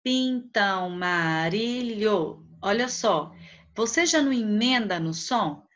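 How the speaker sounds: syllables stretched slowly for counting; drawn out, beat by beat; slow syllable reading. Shown as a demonstration. Teacher reading the line slowly so the class can count syllables. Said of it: "Vamos contar?".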